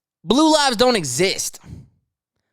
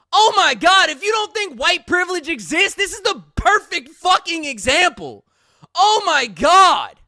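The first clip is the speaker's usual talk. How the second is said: exasperated disbelief; 7 semitones higher than usual. Real, not acted. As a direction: throw up your hands.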